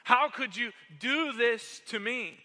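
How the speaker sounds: high, loud, hurt outcry at his brother; furious yelling. Acted. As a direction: yell it as betrayed fury. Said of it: "was furious, yelling at his older brother".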